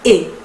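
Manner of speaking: E as a closed "é"; short clear French "é" for E; acute-accent E. Shown as a demonstration. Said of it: "se va a pronunciar".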